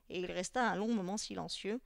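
plain reading voice

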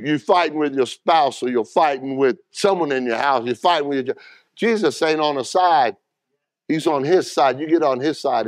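normal talking voice

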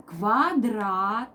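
ordinary delivery

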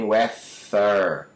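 slow "weather" with voiceless TH; hissed, buzzless middle in "weather"; wrong "weather", TH as in "think"; unvibrated TH. Shown as a demonstration. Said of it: "E nem".

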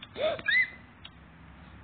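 startled high gasp; a real frightened gasp; sharp gasp. Real, not acted. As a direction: gasp in shock, high and sudden. Sudden attack by monster.

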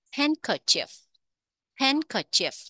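"Handkerchief" with HAND high, then falling; first-syllable stress. Shown as a demonstration. Teacher modelling for learners, high stress on HAND in "Handkerchief". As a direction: hit HAND in "Handkerchief" high, let the rest drop. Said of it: "nhấn âm một các bạn".

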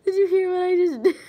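loud high laughing speech. Real, not acted. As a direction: laugh through it. Laughing loudly.

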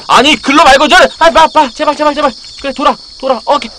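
high, frantic directions; alarmed backseat gaming, voice jumping up; urgent panic. Real, not acted. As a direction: plead with your teammate to turn back. Urgent correction during game.

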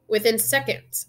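ordinary delivery

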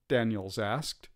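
plain reading voice

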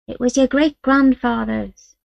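put-on an old woman shows a treasured bridle; reverent old woman. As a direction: voice the old Romany woman, hushed with respect. Great-grandmother voiced.

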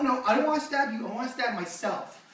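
ordinary talk

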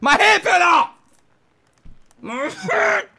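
very high, loud cry of shock; shocked yell. Real, not acted. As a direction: wail over the lost hand. Hand falls off in game.

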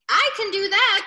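high, loud and keen; eager cry. A narrator's reading. Reader voices Iguana volunteering to get flour, crying eagerly. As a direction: pipe up loudly to volunteer. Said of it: "cried Iguana".

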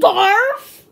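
loud, high startled "Barf?"; shocked. Real, not acted. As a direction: blurt it out in disgust. Shocked at gross flavor.

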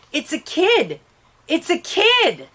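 high, rising shocked exclamation; shocked cry. A narrator's reading. Policeman over the boy, high and shocked. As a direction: blurt it out in horror.

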